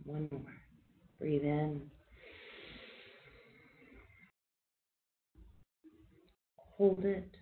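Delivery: soft, calm breathing cues with long pauses; quiet meditation guide counting breaths; guided breathing. Acted. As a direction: ease the group through each breath, gently. Leads a guided breathing exercise.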